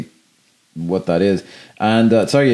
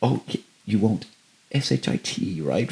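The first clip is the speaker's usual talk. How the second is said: softer, spelling the word out primly; whisper-spell it like a prude; prim quiet spelling out; 8 dB quieter than usual. Retold or imitated. Imitating John Ritter's prim character spelling out a swear, quiet. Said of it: "so quietly and subtly".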